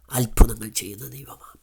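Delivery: reverent whisper; whispered reverent affirmation. Acted. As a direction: breathe the line in a hushed whisper. Sermon hushed close.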